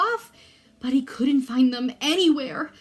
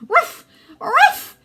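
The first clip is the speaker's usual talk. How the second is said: high loud yapping ruff ruff; high yappy bark; 11 dB louder than usual. A narrator's reading. Small dog yapping a warning at its owner.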